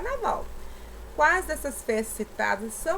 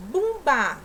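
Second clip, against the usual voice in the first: "Bumba" higher and loud, both syllables clear; emphasised example word; 4 semitones higher, 5 dB louder than usual. Shown as a demonstration. Teacher asking first or last syllable, emphatic "Bumba". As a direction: call out "Bumba" firmly to test its stress. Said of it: "Ó, primeira ou última".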